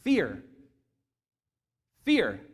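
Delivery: ordinary talk, nothing marked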